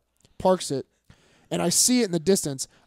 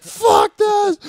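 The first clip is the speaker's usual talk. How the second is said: much higher, louder shout of Fuck this; furious shout; 14 semitones higher, 9 dB louder than usual. Retold or imitated. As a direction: explode in drunk rage. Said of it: "So I'm like".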